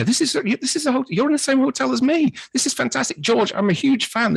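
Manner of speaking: high-pitched, breathless fanboy rush; starstruck gushing. Retold or imitated. Fan gushing over the director at breakfast, high and excited. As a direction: babble at him like an overexcited fan.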